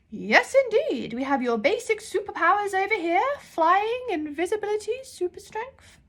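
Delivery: a grand, half-deaf librarian calling out. Acted. Deaf librarian shouts while holding her ear trumpet.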